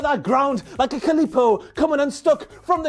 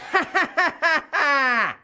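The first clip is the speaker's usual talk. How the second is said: giggle; 4 semitones higher than usual. Real, not acted. Laugh after quote.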